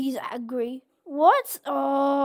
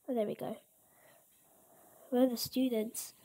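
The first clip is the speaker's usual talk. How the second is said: normal talking voice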